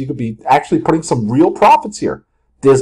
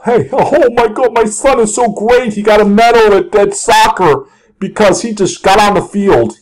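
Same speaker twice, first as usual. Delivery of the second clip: gushing proud parent; 6 semitones higher than usual. Retold or imitated. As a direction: brag about your kid in over-excited tones. Voices a gushing sports parent.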